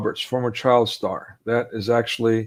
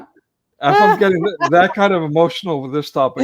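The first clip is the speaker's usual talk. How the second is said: laughing joke; 6 semitones higher, 7 dB louder than usual. Real, not acted. Host joking back, laughing.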